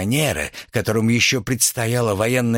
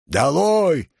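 higher, rounded jeering call; heckler's yell; 5 semitones higher than usual. A narrator's reading. Student shouting Nicole down, a contained yell. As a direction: call it out from the crowd. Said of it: "Эти вопли неслись со всех сторон".

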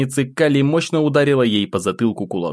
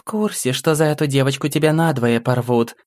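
high, menacing demon woman; demoness voice; 5 semitones higher than usual. A narrator's reading. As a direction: taunt her from behind, coolly. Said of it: "произнесла Кали".

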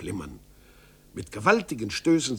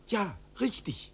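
put-on high young voice, eager question; curious boy; 6 semitones higher than usual. Son asking his father in astonishment, high voice. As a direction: wonder aloud like a child. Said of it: "rief Zäpfel aus".

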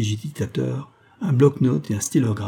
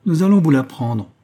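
loud, higher-pitched announcement; emphatic, commanding answer; assertive reply; 6 semitones higher, 7 dB louder than usual. A narrator's reading. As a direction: answer boldly, raising the voice. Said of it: "répondit le petit homme".

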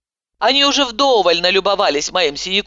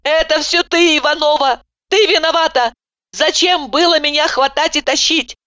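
accusing wail; 10 semitones higher than usual. A narrator's reading. Heroine wails accusations at the doctor. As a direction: blame her at full volume. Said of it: "я завопила с новой силой".

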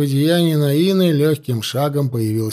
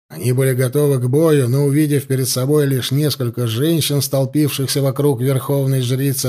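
usual speaking voice

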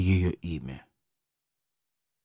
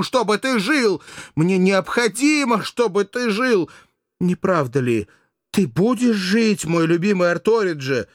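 much higher and louder, slow insistent pleading; will him to live; passionate pleading; 6 dB louder than usual. Acted. Mirdza passionately pleads with dying Arto to live. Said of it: "в порыве любви".

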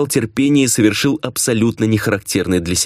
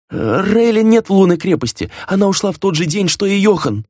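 high, frightened servant blurting out; blurted nervous servant; 11 semitones higher than usual. A narrator's reading. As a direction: spill it out fast, fearing the master. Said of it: "выпалил Роман и застыл".

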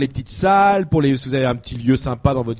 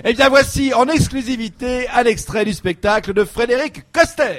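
high, drawn-up showman announcement; announcer introduction; 8 semitones higher than usual. Acted. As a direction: introduce the act with fanfare. Presenter announces the sketch. (annonce de présentateur, voix haute)

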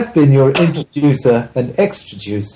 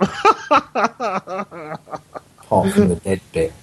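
laughing; 7 semitones higher, 4 dB quieter, slower than usual. Real, not acted. Joke punchline with laugh.

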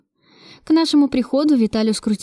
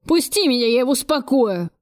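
higher, straining to break free; mild enraged yell; 3 semitones higher than usual. A narrator's reading. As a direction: yell while struggling, volume contained. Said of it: "вопил Кравцов, вырываясь". (негромко сыгранный яростный вопль)

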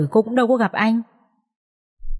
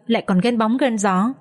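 plain reading voice

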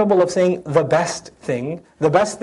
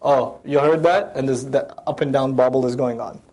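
ordinary talk, nothing marked

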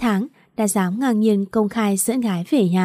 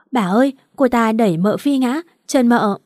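ordinary delivery, nothing marked

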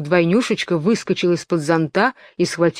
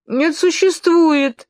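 higher, slow, breaking into tears; tearful protest; 10 semitones higher, 4 dB louder, much slower than usual. A narrator's reading. Alice insisting she is real, close to crying. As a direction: insist through starting tears. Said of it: "сказала Алиса и заплакала".

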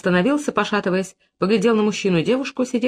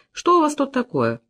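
higher-pitched, patronising question; peacemaker among children; 7 semitones higher than usual. A narrator's reading. Peter stepping into the quarrel, indulgent peacemaker. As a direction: ask like settling a children's squabble. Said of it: "словно его призвали уладить ссору между детьми".

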